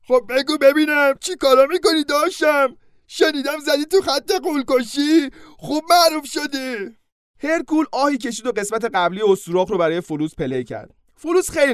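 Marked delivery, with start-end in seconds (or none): 0.1-7.0 higher, slowing centaur voice full of friendly curiosity; cheerful centaur friend. Acted. Old centaur friend greeting Hercules, cheerful and curious. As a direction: catch up with an old pal, teasing.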